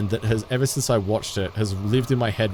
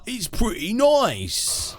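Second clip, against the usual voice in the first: slow, higher hammed-up British accent; mock British accent; 9 semitones higher, slower than usual. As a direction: stretch it out in a put-on British accent. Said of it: "accept the accents".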